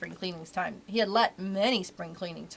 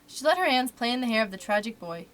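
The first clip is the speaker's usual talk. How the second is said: usual speaking voice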